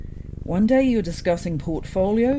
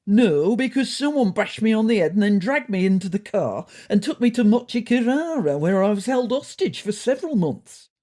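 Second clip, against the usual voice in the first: louder, indignant protest; 5 semitones higher, 6 dB louder than usual. Acted. Smoky O'Neill answering Ron's jibe, indignant and louder. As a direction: huff at the insult, raising the voice. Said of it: "replied indignantly".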